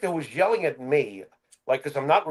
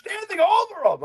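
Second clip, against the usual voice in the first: high, loud mock scream at husband; mock screaming wife; 11 semitones higher, 6 dB louder than usual. Wife screaming at injured wrestler husband, mock yell. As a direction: shriek it like the furious wife. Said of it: "starts screaming at him".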